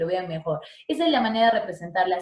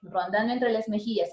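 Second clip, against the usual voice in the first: ordinary delivery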